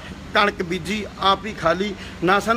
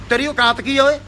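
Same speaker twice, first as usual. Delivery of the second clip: higher, raised and scornful; contemptuous challenge; 4 semitones higher, 3 dB louder than usual. Real, not acted. Sneering at the rival singer's worth, raised voice. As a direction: put him in his place.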